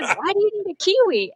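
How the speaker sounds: high-pitched questioning, imitating a teacher; mock-puzzled teacher voice. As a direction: ask a small child, baffled, pitch lifted well up. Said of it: "And her teacher said".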